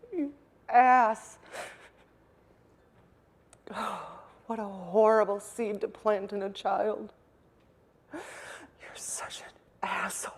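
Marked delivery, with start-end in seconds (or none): 0.1-1.4 loud, bitter insult; angry outburst. Real, not acted. Cursing at father. 8.1-10.3 hushed bitter whisper. Real, not acted. Angry at father, whisper.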